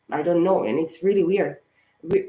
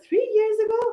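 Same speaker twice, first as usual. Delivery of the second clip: much higher, squeaked-out echo of disbelief; squeaky disbelief. Retold or imitated. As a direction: squeak it back, stunned. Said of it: "I was like".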